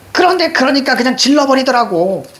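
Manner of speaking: high, aggrieved outpouring; worked-up complaint. Acted. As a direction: pour out the grievance high. Voices upset mother venting.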